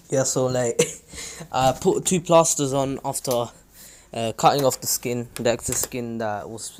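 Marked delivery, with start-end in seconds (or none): none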